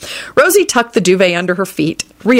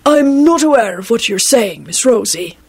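stiffly formal, pitch raised at first; stiff reply; 5 semitones higher than usual. A narrator's reading. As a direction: answer stiffly, on your dignity. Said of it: "he responded stiffly".

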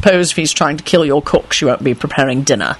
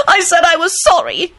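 shriek; 13 semitones higher than usual. A narrator's reading. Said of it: "she shrieked".

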